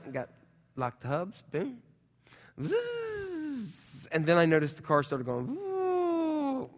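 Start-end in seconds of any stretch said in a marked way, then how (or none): 2.6-4.1 high whining zzz of wheels spinning; tire spin noise. Retold or imitated. Car wheels spinning in mud. 5.6-6.7 high woo of the car slipping; sliding car noise. Retold or imitated. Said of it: "like this".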